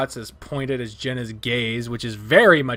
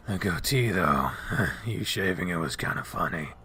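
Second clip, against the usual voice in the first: put-on low, soft, dazed young man; lightly voiced drowsy wolf; 5 semitones lower, 4 dB quieter than usual.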